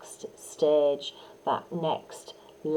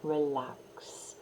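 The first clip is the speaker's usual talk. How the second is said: soft warm whisper; 4 semitones lower, 10 dB quieter than usual. Shown as a demonstration. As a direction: whisper relax on the out breath. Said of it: "in a soft and warm voice".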